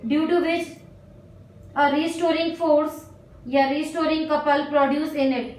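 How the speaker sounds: very slow, word by word, so students can write; slow dictation. Real, not acted. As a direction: dictate each phrase slowly and clearly. Teacher dictates notes to write. (धीरे-धीरे लिखवाना)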